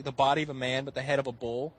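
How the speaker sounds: ordinary talk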